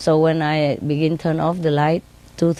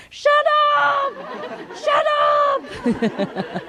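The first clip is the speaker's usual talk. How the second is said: very high, drawn-out shut up; long mock yell; much slower than usual. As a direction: screech shut up, stretching the last vowel. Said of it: "if it take too long and too noisy".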